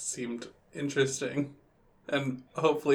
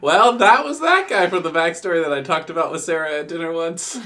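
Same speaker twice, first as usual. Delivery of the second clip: loud outburst; 3 semitones higher, 11 dB louder than usual. Pretend. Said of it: "I'll be like".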